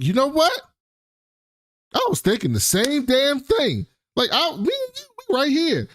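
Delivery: high excited voice for Rob, overeager; eager Rob. Retold or imitated. Retelling Rob jumping at the money plan, high and eager. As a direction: pitch it way up like he can't believe his luck. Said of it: "And Rob was like".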